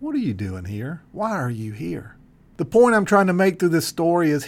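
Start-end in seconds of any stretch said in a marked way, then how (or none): 0.0-2.2 much lower and softer, a still small voice; the still small voice of God after the storm. Acted. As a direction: ask it gently, barely above a hush. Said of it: "God speaks to him in a small voice".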